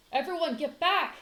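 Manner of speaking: loud, high urgent command; shouted warning. Acted. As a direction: shout them clear of the door. Rescuer orders trapped family back.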